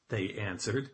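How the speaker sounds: ordinary delivery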